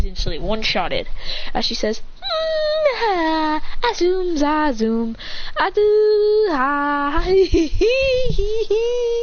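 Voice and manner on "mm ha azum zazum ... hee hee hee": high giggling nonsense cackle; gloating girl cackling over beaten boys; evil cackle. Acted. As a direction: cackle like a gleeful villain. Villain cackle.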